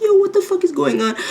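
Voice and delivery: high disbelieving squeal; high, shocked mock outcry. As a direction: yelp it in total disbelief. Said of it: "I was like".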